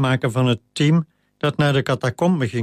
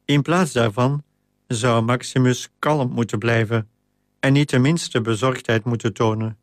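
ordinary delivery, nothing marked

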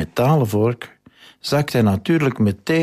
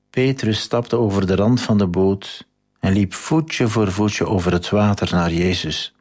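normal talking voice

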